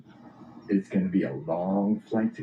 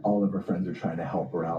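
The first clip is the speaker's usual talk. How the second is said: usual speaking voice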